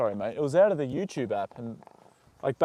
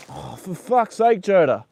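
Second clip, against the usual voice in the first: slow, louder, higher exasperated groan at the horse; exasperated outburst; 6 semitones higher, 6 dB louder, slower than usual. Real, not acted. Owner fed up as his horse ruins the bandage, exasperated. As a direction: let the frustration out, drawn out.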